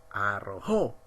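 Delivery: arrojó, low, with weight on "jó"; stress on final syllable. Shown as a demonstration. Teacher listing aguda examples, arrojó stressed on "jó". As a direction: lean on the end of arrojó. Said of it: "la mayor fuerza de voz en la última sílaba".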